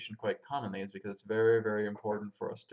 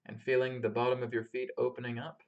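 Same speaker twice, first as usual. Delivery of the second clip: calm, unhurried meditation guide; grounding meditation. Acted. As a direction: lead them gently through the breath and body. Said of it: "we're gonna start off with a grounding meditation".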